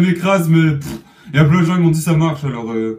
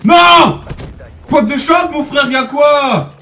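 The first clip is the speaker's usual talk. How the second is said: high loud protest; outraged yell; 9 semitones higher, 6 dB louder, much slower than usual. Real, not acted. Gamer protesting a kill, loud indignant yell. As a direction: shout it out, indignant. Killed, yelling.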